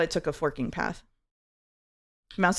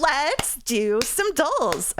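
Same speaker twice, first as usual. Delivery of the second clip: slow drawn-out; 8 semitones higher, much slower than usual. Pretend. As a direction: stretch every word out slowly. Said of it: "I'm never doing that again".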